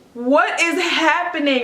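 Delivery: high, drawn-out retold bewilderment; bewildered alarm. Mother surrounded by rushing nurses, bewildered. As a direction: act out being overwhelmed and lost. Retells panic as nurses rush about.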